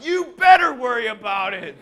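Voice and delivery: very high, very loud mock bellow; hammered-out threat; threatening shout. Angry customer bellowing a last warning.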